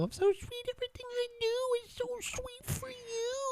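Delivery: put-on squeaky high baby voice; high cutesy voice. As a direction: coo it up high and sweet. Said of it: "That was really cute".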